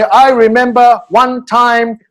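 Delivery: very slow, careful learner English; an audience member answering in halting English. Retold or imitated. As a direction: spell out each word like a language student. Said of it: "face the audience and say".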